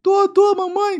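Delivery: squealing mock sob in falsetto; fake-cry a yes to mummy; very high hammed-up whimper; imitated crying. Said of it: "meu filho tá chorando".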